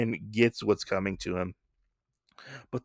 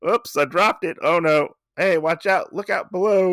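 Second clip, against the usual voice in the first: higher, louder fake-innocent warning; mock innocent oops; 6 semitones higher, 3 dB louder than usual. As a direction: play it like a sly accident. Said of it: "like, type of thing".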